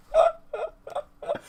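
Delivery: high, helpless giggle; laughing fit. Real, not acted. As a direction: break up in high-pitched laughter. Laughing at the mutual parody.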